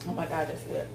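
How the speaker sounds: normal talking voice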